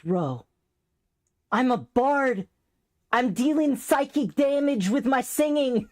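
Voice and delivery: high-pitched playful proclamation; mock boast. Retelling a joke to a teased friend, high mock boast. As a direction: announce it high and hammed-up, like a silly brag. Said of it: "after I did it, I was like".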